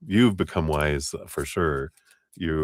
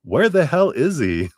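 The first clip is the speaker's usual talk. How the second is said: high, loud, exasperated where-is-he; hammed-up frantic searching, pitched way up; exasperated mock search; 10 semitones higher, 6 dB louder than usual. Friend mocking the client's search for him, loud and high. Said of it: "this is like you going".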